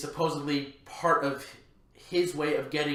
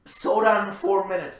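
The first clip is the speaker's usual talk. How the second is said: louder, higher, hammered home; loud emphasis; 5 semitones higher, 7 dB louder than usual. Acted. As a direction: punch it out loudly. Repeated for emphasis.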